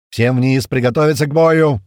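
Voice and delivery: loud battle command, rising pitch; shouting. A narrator's reading. Commander sending everyone below between shots, shouting. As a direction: shout orders over gunfire. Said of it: "прокричал Туман между выстрелами".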